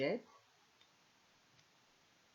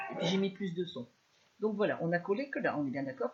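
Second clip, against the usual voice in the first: projecting, noticeably louder than before; speaking louder; 7 dB louder, faster than usual. Real, not acted. Crafter raising her voice for livestream viewers, louder. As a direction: push the voice out so viewers hear. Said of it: "Je vais parler plus fort".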